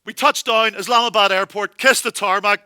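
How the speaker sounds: ordinary talk, nothing marked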